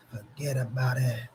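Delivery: soft, breathless whisper; whispered punchline. Shown as a demonstration. Streamer to chat, ending the Brasco story in a whisper. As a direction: hush it like a mob secret.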